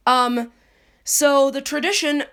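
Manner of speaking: ordinary delivery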